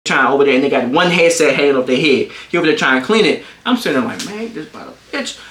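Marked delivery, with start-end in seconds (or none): 4.3-5.4 soft, under-the-breath disbelief; muttered aside. Retold or imitated. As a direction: mutter it privately to yourself. Private reaction while watching mess.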